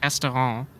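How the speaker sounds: "Restaurant" French-style, nasal ending with no final t; German pronunciation. Shown as a demonstration. German guest gives the German word, "Restaurant" with a French-style ending. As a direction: say "Restaurant" as "res-to-ron", last consonants dropped. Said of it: "say that restaurant in German".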